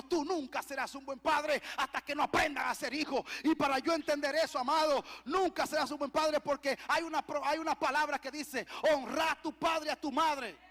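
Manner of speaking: loud, driving sermon delivery; impassioned preaching. Acted. Preacher relaying God's command on fathers, loud and impassioned. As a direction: hammer the word home to the congregation.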